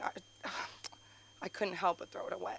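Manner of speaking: a hushed, near-whispered imitation of a tearful girl; lightly imitating a girl crying over the animal; mock crying girl. Kid confessing she threw it, imitating her crying softly. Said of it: "a girl's crying saying".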